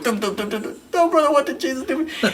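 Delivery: hammed-up frightened kid; panicked squeak. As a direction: squeak it out, cornered and lost. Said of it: "I'm going".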